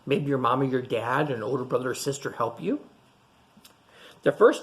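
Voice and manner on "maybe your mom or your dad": ordinary delivery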